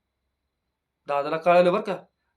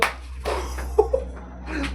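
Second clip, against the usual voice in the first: high breathy mock laugh, no voice; imitated strange laugh; 9 semitones higher than usual. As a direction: copy his odd airy laugh as a joke. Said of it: "बाबा, असं हसत नको जाऊ रे बाबा".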